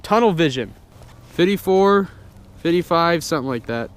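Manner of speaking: ordinary talk, nothing marked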